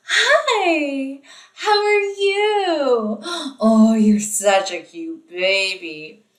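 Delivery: put-on high, slow, singsong baby talk. Shown as a demonstration. Teacher showing how adults coo at a baby. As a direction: coo at the baby like you're babysitting. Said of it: "if you're anything like me, you're probably going".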